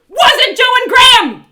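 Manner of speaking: high, full-volume shouted question; exasperated bellow; furious demand. Acted. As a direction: shout it point-blank across the counter. Slaps counter, demanding an answer.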